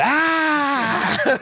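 sudden high shouted Ah; loud shout. Retold or imitated. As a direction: burst out with a loud Ah. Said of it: "imagine me coming going".

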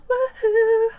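high, loud whooping cheer; excited cheer. Real, not acted. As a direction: whoop on request. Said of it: "get you to do that woohoo sound".

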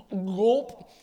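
big, loud cartoon gulp; imitated gulp. Musician swigging from the offered bottle, loud mock gulp. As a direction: exaggerate one huge swallow. Mimics swallowing a big mouthful.

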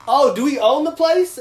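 loud, high mock-incredulous retort; sarcastic comeback. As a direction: scoff back at the guy. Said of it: "I was like".

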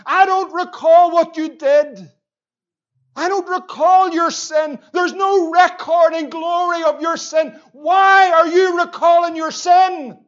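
voicing God, building from firm to a full shout; God's voice rising to a shout. Preacher voicing God to the congregation, building to a shout. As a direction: let God's words swell into a loud shout.

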